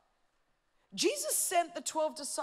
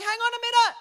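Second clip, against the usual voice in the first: higher, indignant "hang on"; complaining church member; 10 semitones higher, 13 dB louder, faster than usual. Retold or imitated. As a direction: object like an offended congregant. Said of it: "you've got the people going".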